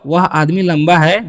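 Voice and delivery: ordinary talk, nothing marked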